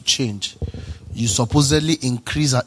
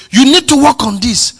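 higher and louder, half-acting a mentor's rebuke; a touch of a stern mentor; 13 semitones higher, 11 dB louder than usual. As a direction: play the mentor correcting you, pushing it up. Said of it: "If your mentor looks at you and says".

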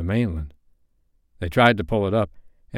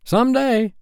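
very high, louder sidekick; bosun character; 5 dB louder than usual. A narrator's reading. Smee warning his captain, high loud bosun voice. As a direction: pipe up loudly in a high voice.

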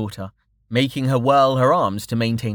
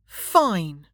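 put-on huffy child giving in; 7 semitones higher than usual. A narrator's reading. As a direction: concede with a huff. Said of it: "Ava said with a huff".

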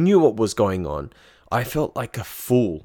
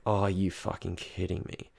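much softer, muttered to himself; muttered disbelief; 3 semitones lower, 11 dB quieter than usual. Retold or imitated. Seeing the pricey bottle, he mutters to himself in disbelief. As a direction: mutter it under your breath in disbelief. Bottle arrives, inner reaction.

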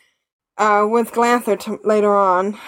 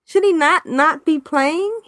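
higher-pitched, pointed "hello?" attitude; higher, teasing mock objection; mock incredulous; 6 semitones higher than usual. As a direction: point out the obvious with amused disbelief. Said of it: "and then I'm thinking".

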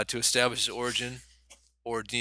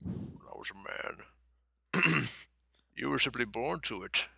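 Sean Connery impression; 5 dB quieter, slower than usual. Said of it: "yours sounds a little bit like Sean Connery".

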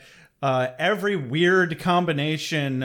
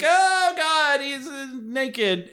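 shocked gasp; 9 semitones higher, much slower than usual. Retold or imitated. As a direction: play the crowd gasping at the naked kid. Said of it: "they're all like".

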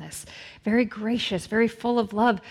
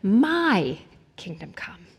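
slow breathy whisper, "My kingdom come" drawn out; the selfish prayer whispered to herself, slow; 2 semitones lower, slower than usual. Shown as a demonstration. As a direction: confess the secret wish under your breath. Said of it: "for me to pray".

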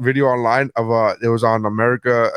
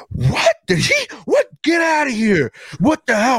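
high, outraged mock disbelief; shocked disbelief; 11 semitones higher than usual. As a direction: play the whole room aghast. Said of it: "the entire fucking room goes".

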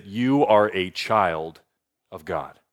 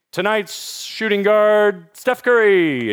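arena announcer; 8 semitones higher, much slower than usual. Retold or imitated. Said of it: "and they say".

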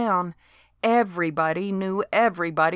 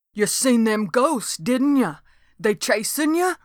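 Dode's voice; 5 semitones higher than usual. A narrator's reading. Dode voiced in story.

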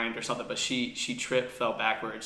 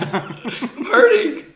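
very high, slow hammed-up wail; mock wail; 16 dB louder, much slower than usual. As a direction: drag out a fake sob, laughing. Mock hurt feelings banter.

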